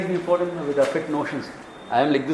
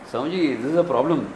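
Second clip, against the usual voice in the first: teasing fast quip; much faster than usual. Acted. Devotee teases Swamiji in Hindi.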